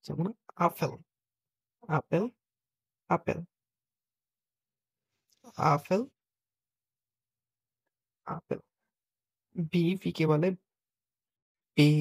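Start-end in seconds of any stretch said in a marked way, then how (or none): 0.0-8.6 আপেল repeated with German A sound; German pronunciation demo. Shown as a demonstration. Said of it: "জার্মানিতে বলে আ". (আপেল শব্দটি German উচ্চারণে বারবার)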